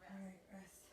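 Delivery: breathy whisper, barely voiced. Real, not acted. Catching breath between drills.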